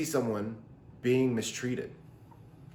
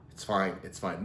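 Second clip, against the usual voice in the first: mock denial; 5 semitones lower, 8 dB quieter than usual. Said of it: "In denial".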